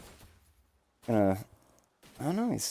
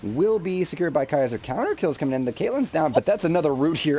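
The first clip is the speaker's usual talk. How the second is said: energetic, quick-fire caster call; live play-by-play; 5 semitones higher than usual. Real, not acted. Caster calling a string of kills, excited play-by-play. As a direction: ride the action, calling each kill with excitement.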